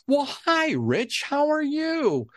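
high, drawn-out easygoing greeting; imitating Roger's slow speech. Mimicking the producer's unhurried phone greeting, high and slow. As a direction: stretch it out lazily, nothing fast. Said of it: "Roger just has this certain speech pattern".

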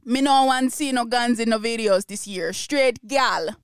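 mock Jamaican patois read of the caption; joking Jamaican accent impression. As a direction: put on a playful Jamaican accent. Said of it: "so it's probably in a Jamaican accent".

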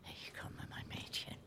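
faint breathy whisper, barely audible. Acted. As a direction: whisper it as if fading away. Dying man whispering to granddaughter.

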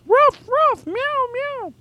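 very high falsetto barks and meows; animal noises. Acted. Imitating dog and cat noises.

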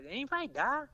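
imitating his own panicked question; retold alarmed question. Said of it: "And then I was like".

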